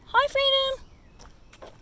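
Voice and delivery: slow, high drawn-out coo; parrot voice. Real, not acted. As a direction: mimic a parrot's greeting. Greeting pet parrot.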